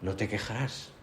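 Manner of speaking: put-on light touch of an Aragonese accent; lightly imitated Aragonese accent. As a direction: quote the villager with a slight Aragonese lilt. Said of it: "así con un cierto acento aragonés".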